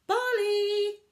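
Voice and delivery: high, loud call across the woods; mother calling her cub home; mum calling out. A narrator's reading. Mother bear calling her cub home, loud call. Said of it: "his mum called again".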